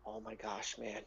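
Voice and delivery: hushed, worried whisper; concerned. Acted. Gnome realising the danger, worried whisper. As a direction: breathe it out under your breath in dread. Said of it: "really concerned look over their face".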